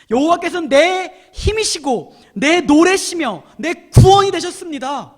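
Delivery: slow, loud, exultant scripture reading; overflowing emotion. Shown as a demonstration. Preacher showing how to read Moses' song, overflowing emotion. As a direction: proclaim it with swelling force, stretching the words. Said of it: "이 정서를 가지고 한번 읽어보세요".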